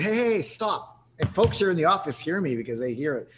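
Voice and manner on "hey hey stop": slow, higher cut-in; stretched-out firm interruption of a pitching rep; firm stop. Retold or imitated. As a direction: halt the caller mid-pitch, drawn out. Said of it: "And I said".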